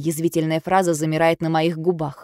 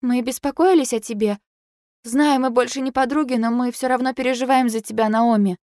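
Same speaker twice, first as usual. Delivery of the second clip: higher, pleading friend voice; a hint of a worried girl; lightly voiced worried friend; 7 semitones higher than usual. A narrator's reading. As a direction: plead gently as the anxious friend.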